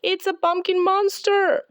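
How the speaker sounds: put-on scared little girl shouting; frightened cry. A narrator's reading. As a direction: scream it as the thing rolls at you. Lucy frightened by rolling pumpkin.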